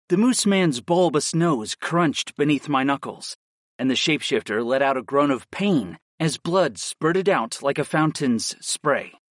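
ordinary delivery, nothing marked

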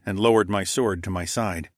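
ordinary delivery

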